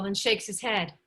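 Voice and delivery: ordinary talk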